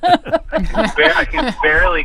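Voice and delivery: high squeak of a laugh. Real, not acted. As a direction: laugh it out in mock despair. Laughing at own overload.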